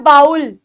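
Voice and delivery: बाउल with "ow" as in owl; common mispronunciation. Shown as a demonstration. Teacher repeating what many people say, "ow" glide in बाउल. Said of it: "कई लोग इसको बोलते हैं".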